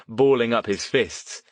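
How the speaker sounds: ordinary delivery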